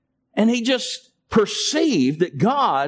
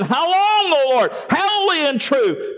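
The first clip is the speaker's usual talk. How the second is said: loud cry; 8 semitones higher than usual. Acted. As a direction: cry out in loud, pleading appeal. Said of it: "they cried out with a loud voice".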